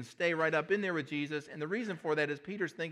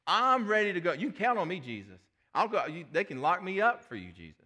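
higher, louder eager vow; eager boast; 3 semitones higher, 5 dB louder than usual. Acted. Peter vowing loyalty to Jesus, eager, higher and louder. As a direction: swear loyalty with big enthusiasm.

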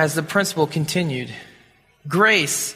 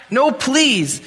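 begging; 8 semitones higher than usual. A narrator's reading. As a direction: beg the blows to stop. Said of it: "rebel begged".

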